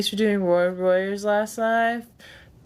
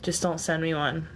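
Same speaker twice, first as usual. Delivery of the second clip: ordinary talk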